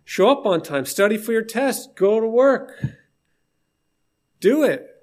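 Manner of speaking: rising exasperated urging; firm pushing advice. Retold or imitated. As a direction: push him to just do it. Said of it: "And I said".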